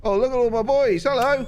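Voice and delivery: put-on squealing baby-talk coo over the pods. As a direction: gush over the babies like a proud parent. Cooing over new baby pods.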